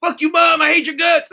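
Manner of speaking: high, loud bratty yell at dad; bratty kid yelling. Retold or imitated. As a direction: scream it like a spoiled teenager.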